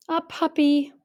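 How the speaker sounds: light up as the pup appears; delighted reveal. A narrator's reading. Narrator revealing the puppy with delight.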